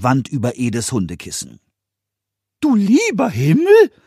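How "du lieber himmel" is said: hohe, laute Koboldstimme, fragend; Pumuckl echot. A narrator's reading. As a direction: Kobold plappert verwundert nach. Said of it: "echote der Pumuckl". (Pumuckl wiederholt fragend Eders Ausruf, hoch und laut)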